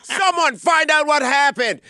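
high, loud mock outrage; mock outraged comics. Mocking Canadian comics' thread, high outraged yell. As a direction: shriek it like panicked comics. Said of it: "they're like".

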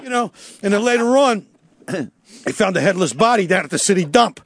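ordinary delivery

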